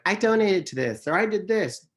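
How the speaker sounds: high-pitched mock bragging; show-off quote, high and emphatic; boastful imitation. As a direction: imitate people showing off, pitched well up. Quoting people announcing their donations.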